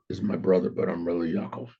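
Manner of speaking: low, soft muttered aside; under his breath. Retold or imitated. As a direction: slip it out under your breath, dropped way down in pitch. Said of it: "And under his breath".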